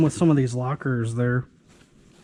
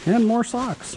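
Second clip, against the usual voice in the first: exasperated; 9 semitones higher, slower than usual. Real, not acted. Yet another pair of socks.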